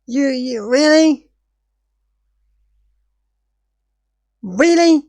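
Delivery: incredulous. Real, not acted. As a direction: stretch out the disbelief. Disbelief at wrong footage.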